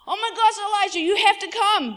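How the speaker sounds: high, loud panicked servant; freaking out. Acted. Servant running back in to his master Elijah, freaking out. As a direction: act out a frantic alarm call. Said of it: "freaks out, comes running back in".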